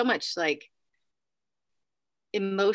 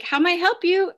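high, louder receptionist voice; front desk greeting; 10 semitones higher, 6 dB louder than usual. Retold or imitated. Front desk girl answering the elevator call, high polite greeting. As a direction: imitate a receptionist's high-pitched phone greeting.

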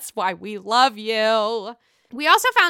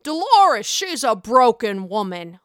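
imitating Joe Gorga; 5 dB louder than usual. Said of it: "Joey Gorga, what'd you say".

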